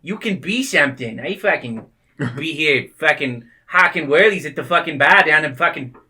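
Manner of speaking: loud, rambling mock Boston accent; fake Boston slang. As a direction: ham up Boston tough guy slang. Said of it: "I was just trying to make fake Boston slang".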